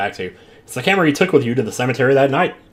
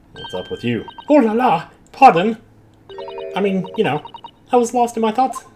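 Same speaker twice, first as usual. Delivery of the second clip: high, theatrical French lady; a flustered Frenchwoman, voiced high; French madame voice; 11 semitones higher than usual. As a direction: play her as a flustered Parisian madame.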